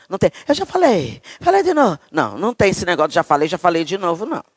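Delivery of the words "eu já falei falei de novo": high-pitched parody of scolding; mock exasperated nagging. Parent repeating an order to a child, mock exasperated nagging.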